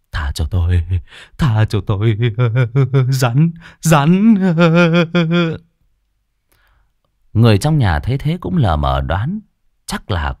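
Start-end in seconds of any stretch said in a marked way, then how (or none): 0.1-2.2 mê sảng van xin. Acted. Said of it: "lên mồm làm nhảm". 2.2-3.0 high, loud, unhinged laugh; crazed delirious laugh. Acted. Delirious official laughs in fright. 3.1-4.3 higher, louder terrified raving; delirious raving. Acted. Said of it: "lên mồm làm nhảm". 4.4-5.6 high, loud, unhinged laugh; crazed delirious laugh. Acted. Delirious official laughs in fright.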